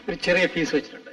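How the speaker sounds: low, hushed demand; sly aside. Acted. Go-between quietly naming a fee for his information, sly. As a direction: drop it low and confidential.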